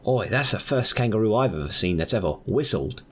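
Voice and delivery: put-on Australian voice, opening with "oi"; hammed-up Aussie exclamation; Australian accent. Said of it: "he's clearly Australian".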